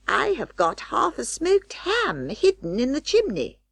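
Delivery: high squeaky orders, in a hurry; Anna Maria voice. Acted. Rat wife bossing her husband to pack up, high and bossy.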